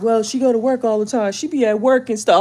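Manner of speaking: put-on voice making lame excuses; lightly voiced boyfriend making excuses; imitating the boyfriend. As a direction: mimic him brushing it off. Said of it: "He be like".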